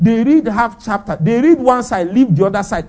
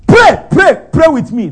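high, loud shouted pray; shouting the retold command; 7 semitones higher, 8 dB louder than usual. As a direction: call it out like the preacher did. Retold preacher calling out.

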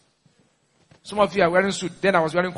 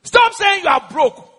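high, slow pulpit shout; emphatic preacher's command, pitched high; shouted rebuke; 11 semitones higher, 4 dB louder than usual. Real, not acted. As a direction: scold the crowd at a raised pitch.

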